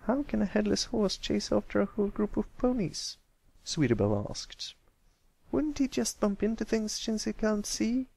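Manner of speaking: high filly voice, picking holes; doubtful filly. Acted. Sweetie Belle poking holes in the story, high. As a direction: question it innocently.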